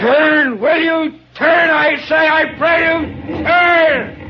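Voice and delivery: yelled high and loud across the water; desperate shout. Acted. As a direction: bellow it, begging the ship to turn. Shouting at ship to turn.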